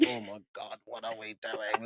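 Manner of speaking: a quiet cringing thought to yourself; inner voice. Retold or imitated. Drunk but cringing inwardly in the hotel, soft hushed inner voice. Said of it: "inside my own body".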